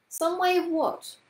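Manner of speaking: put-on high, puzzled child's question. A narrator's reading. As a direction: ask it high and uncertain. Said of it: "after a moment's uncomfortable silence".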